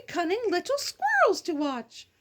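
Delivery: plain reading voice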